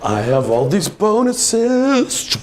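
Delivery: high, slow smug gloat. Pretend. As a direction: savour it smugly. Player gloats over bonuses.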